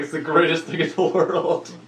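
retelling own delighted reaction to the story; excited. Retold or imitated. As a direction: relish every word with delight. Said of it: "I'm like".

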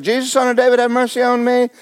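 high pleading cry for mercy; a beggar calling from the roadside; blind man crying out. Acted. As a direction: call out to Jesus, high and pleading. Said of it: "began to cry out and said".

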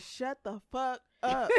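ordinary talk

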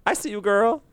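high, put-on approving voice; playfully sassy. Host cheering on the word Cornish, playfully sassy. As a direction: pitch it up in mock admiration.

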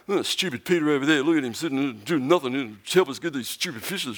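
fast, put-on whiny grumble; imitating the other disciples' grumbling; mock grumbling. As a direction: grouse like a fed-up fisherman. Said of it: "you can hear muttering".

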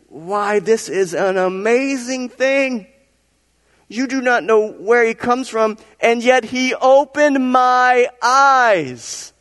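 high, slow exclamation of wonder; amazed blind man. Acted. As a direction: stretch out the astonishment, voice lifted high. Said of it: "That's how he said it".

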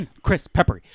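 loud, manic bursts imitating a TV cook; fast, crazy. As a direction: blurt it out, mock crazy. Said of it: "she says it so fast and, like, crazy".

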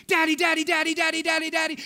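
imitating an excited child, high and loud; high, loud, rapid-fire child's chant; Esther. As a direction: rattle it off like a thrilled little girl. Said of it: "I hear Esther go".

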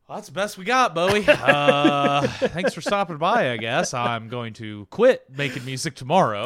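loud, awkward mock farewell; hammed-up embarrassed goodbye; mock crushed. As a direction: play a humiliated musician, loudly saving face. Acts out crushed band answering [name].

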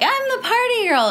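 put-on high, singsong party-girl voice; high mock party-girl quote. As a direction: chirp it like a carefree party girl. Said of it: "I couldn't just be like".

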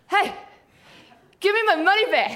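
high, loud shouted confrontation with a laugh; loud demand. Retold or imitated. Tourist confronting huddled men, loud laughing demand. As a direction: call out to the men sharply.

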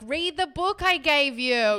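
high, loud and slow, fed up with him; exasperated retort. Retold or imitated. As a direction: snap back at the boyfriend, drawn out. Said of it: "And I'm like".

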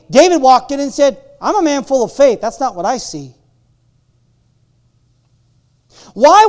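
loud, high, rising preacher voice; fervent preaching. Acted. Pastor challenging the church to see faith, full-force preaching. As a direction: drive each phrase home.

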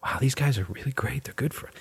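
whispered, sarcastic admiration; mock awed whisper. Mocking viewers who admire the corporations, whispered sarcastically. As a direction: hush it like a dazzled fan, sarcastically. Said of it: "we're all sitting here going".

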